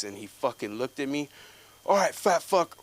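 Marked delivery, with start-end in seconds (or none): 1.9-2.8 angry challenge. Retold or imitated. Him challenging the coach.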